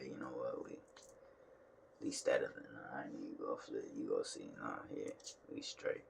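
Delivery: quiet low self-talk, mostly whispered; muttering to himself. Retold or imitated. Vlogger retelling his quiet low deliberation over the laundry. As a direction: talk it over under the breath. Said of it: "I said".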